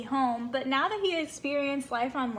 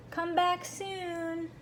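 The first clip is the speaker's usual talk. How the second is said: higher, slow, drawn-out goodbye; slow unicorn farewell; 5 semitones higher, much slower than usual. Acted. Unicorns seeing Kelp off to sea, slow and fond. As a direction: linger on each word like a fond unicorn.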